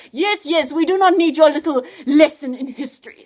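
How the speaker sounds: loud, impatient snapping villain; cross. Acted. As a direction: cut him off crossly. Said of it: "said the evil genius crossly".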